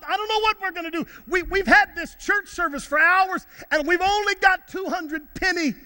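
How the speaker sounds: high-pitched, mildly agitated protest; lightly acted fretting over a shortfall; worked-up disciple. Voices Philip's worry to Jesus.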